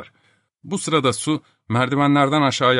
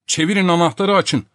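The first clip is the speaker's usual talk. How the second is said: higher, impatient instruction; impatient order; 7 semitones higher, 4 dB louder than usual. Acted. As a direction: tell them impatiently through the door. Quarrel through a jammed, locked door. (sabırsızca emrederek)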